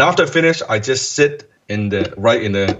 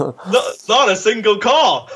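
laughing through the words, higher and slow; 10 semitones higher, slower than usual. Real, not acted. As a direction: laugh it out in disbelief. Recounting no calls, laughing.

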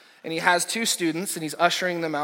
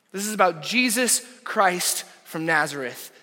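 louder and higher, Jesus stressed; preaching loud; 4 semitones higher, 5 dB louder than usual. Shown as a demonstration. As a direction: ring it out, proclaiming.

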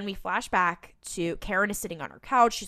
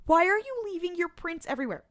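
exasperated rant; 8 semitones higher, 4 dB louder than usual. Retold or imitated. Exasperated rant at careless characters.

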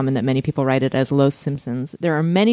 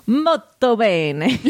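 slow, raised, hammed-up Italian accent; 5 semitones higher, much slower than usual. Host greeting the Italian listeners, hammed-up Italian accent. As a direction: play up the Italian, drawing out each word. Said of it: "what do you think of [name]'s accent?".

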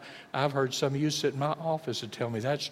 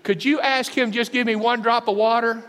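high, louder pleading plea; begging rich man; 8 semitones higher, 7 dB louder than usual. Retold or imitated. Rich man in hell begging for one drop, pleading. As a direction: beg for one drop from the pit. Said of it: "here's what he said".

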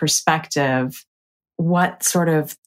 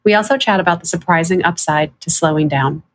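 upbeat, slightly louder host opener over music; podcast intro read; 3 semitones higher, 5 dB louder than usual. Acted. Host opening the show for listeners, bright podcast read. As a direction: welcome listeners into the episode.

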